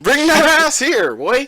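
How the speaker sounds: high, drawn-out playful call; teasing high-pitched order; mock summons. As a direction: stretch it out like calling someone over. Calls out to friend who leaves.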